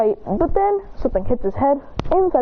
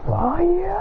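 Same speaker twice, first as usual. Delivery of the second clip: higher, drawn-out rising cry; attack call; 7 semitones higher than usual. Acted. He tries to shoot fire while chained, attack call. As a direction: call out a power move.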